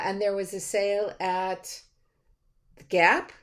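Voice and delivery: "Gap" swung sharply up as a question; Valley Girl uptalk. Shown as a demonstration. Telling about a sale at the mall, in Valley Girl uptalk. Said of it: "That's up talk".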